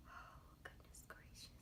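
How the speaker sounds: barely-there whisper of dismay; whispered exclamation. Real, not acted. Vlogger whispers to herself mid-eyeliner, hushed. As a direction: whisper it under your breath. Aside during a tricky eye step.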